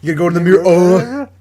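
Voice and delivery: loud, rising "Mirror" that drops the final R; mocking British mirror. An American mocks his friend's British "Mirror", loud and rising. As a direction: bark out "Mirror" as a Brit would, rising, no R at the end. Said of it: "still making fun of it".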